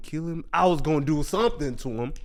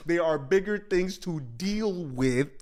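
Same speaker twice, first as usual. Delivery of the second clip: ordinary talk, nothing marked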